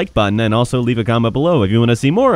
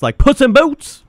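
high, loud drawn-out taunt; mock challenge; 12 semitones higher, 6 dB louder, slower than usual. Player taunting someone to fight his own size, mock challenge. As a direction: blurt it out high and stretch it.

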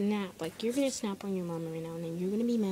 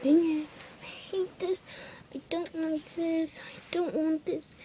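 higher, slow, sulky whine; whiny complaint; 8 semitones higher, slower than usual. Retold or imitated. Girl mimicking a fed-up girl, whiny and slow. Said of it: "you're gonna feel like".

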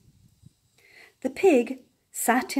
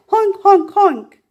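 higher and much louder honking; loud goose honk; 9 semitones higher, 9 dB louder than usual. A narrator's reading. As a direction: trumpet like a goose.

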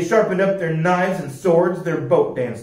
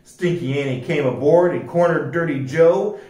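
usual speaking voice

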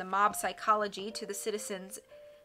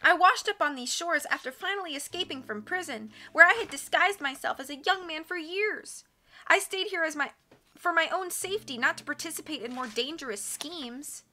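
voiced islander woman; 8 semitones higher, 5 dB louder than usual. Woman's confession among islanders.

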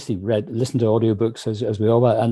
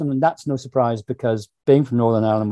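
ordinary delivery

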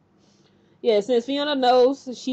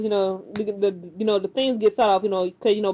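usual speaking voice

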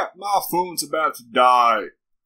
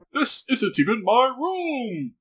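alarmed surprise; 10 semitones higher than usual. Real, not acted. Realising it's not his room.

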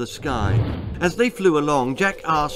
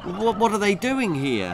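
high, hesitant question; uneasy, higher-pitched boy; worried boy; 7 semitones higher than usual. Acted. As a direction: stammer it in dismay.

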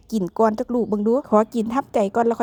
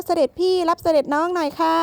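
playful royal call; 6 semitones higher than usual. Pretend. Playfully calls partner to take basket.

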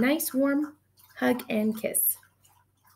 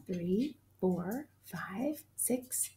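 whispered counting; 3 semitones lower, 10 dB quieter, much slower than usual. Real, not acted. Counting rocks, drops to whisper.

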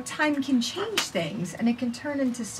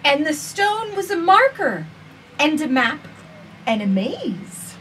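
gentle, measured storybook reading; reading to children; slower than usual. Acted. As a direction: read it slowly to little listeners. Reads picture book aloud.

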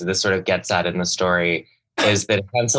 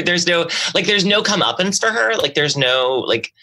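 ordinary talk

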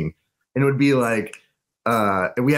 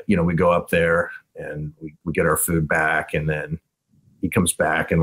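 normal talking voice

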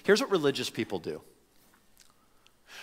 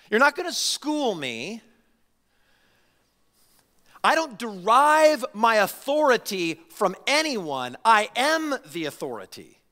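authoritative Jesus; 9 semitones higher, 5 dB louder than usual. Retold or imitated. Jesus rebuffing those who question his authority, forceful and commanding. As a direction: refuse to be questioned, stretching the words forcefully. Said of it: "In other words, Jesus goes".

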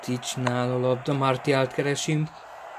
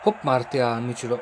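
excited hungarian sports commentary. Real, not acted. Live excited match commentary.

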